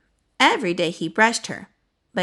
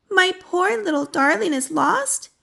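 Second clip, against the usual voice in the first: dismayed; 9 semitones higher than usual. A narrator's reading. Owner hears her dog is lost.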